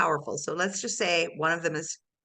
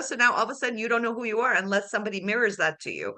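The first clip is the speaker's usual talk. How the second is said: ordinary delivery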